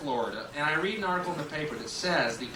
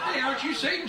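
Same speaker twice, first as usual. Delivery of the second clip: mocking shopper; 11 semitones higher, faster than usual. Shopper spots Satan.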